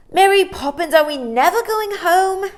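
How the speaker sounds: put-on high, loud whiny boy's voice; cross little boy. Small boy whining crossly at his nanny. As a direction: whine it like a fed-up child. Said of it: "he said crossly".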